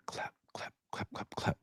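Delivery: whispered clap clap. Retold or imitated. Mimicking stadium fans, whispered claps. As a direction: breathe the claps softly. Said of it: "fans chanting".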